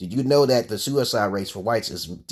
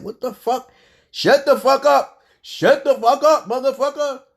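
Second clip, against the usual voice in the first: high, slow, snapping shout; retold angry yell; 6 dB louder than usual. Telling off the voice chanting spell in his head, angry high yell. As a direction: bark it out slow and furious, pitch way up. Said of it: "I'm like".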